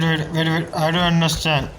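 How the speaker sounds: normal talking voice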